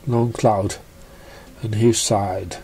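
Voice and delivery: plain reading voice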